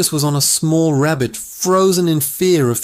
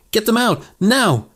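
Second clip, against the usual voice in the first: mildly raised yell, pitched up; screamed order; 7 semitones higher than usual. A narrator's reading. Commanding officer ordering his men to get them out, restrained scream. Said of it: "he screamed".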